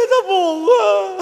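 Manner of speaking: very slow, half-laughing mock cry; laughing fake sob. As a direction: fake a sob while cracking up. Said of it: "Sao mày khóc".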